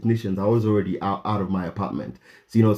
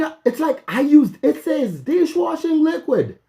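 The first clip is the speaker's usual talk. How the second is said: incredulous. Real, not acted. Realizing the label said dishwashing liquid, high incredulous. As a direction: ask it in utter disbelief.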